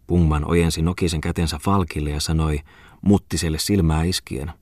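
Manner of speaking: ordinary delivery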